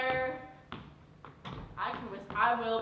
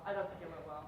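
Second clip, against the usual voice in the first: lower, softer, deflated; dejected; 8 semitones lower, 8 dB quieter, faster than usual. Acted. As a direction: let it sink, defeated. Repeats it dejectedly.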